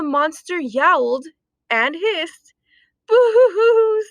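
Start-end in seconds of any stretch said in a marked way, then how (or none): 3.1-4.0 high loud boo-hoo sob; cartoon sobbing dogs; mock sobbing. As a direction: wail like a crying puppy. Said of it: "sobbed the dogs".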